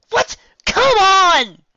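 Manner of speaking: very high, loud squeal. Acted. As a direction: burst out with joy. Pinkie voice.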